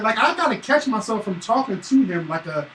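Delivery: ordinary talk, nothing marked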